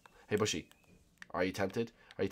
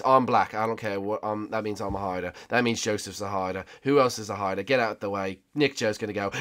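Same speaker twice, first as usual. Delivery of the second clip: ordinary talk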